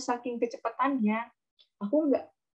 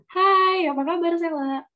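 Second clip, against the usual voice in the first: greeting pitched way up and loud; high pitch; 8 semitones higher, 6 dB louder than usual. Shown as a demonstration. Teacher shows a high-pitched greeting to Sela, loud and high. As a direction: call out the hello high and bright. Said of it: "Nada tinggi".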